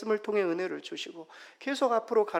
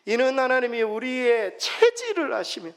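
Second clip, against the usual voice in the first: loud, high group reading of scripture; scripture reading aloud; 6 semitones higher, 7 dB louder than usual. Acted. Pastor leading the congregation in a psalm, loud unison reading. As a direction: read it out together with the congregation. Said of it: "같이 한번 읽겠습니다".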